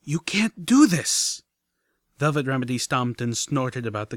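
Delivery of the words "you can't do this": loud, slow, indignant outburst; drawn-out furious protest; outraged cry. Acted. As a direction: shout her horror at the plan. Velvet stomping, furious at plan.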